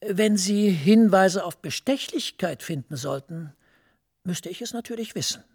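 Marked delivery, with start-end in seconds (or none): none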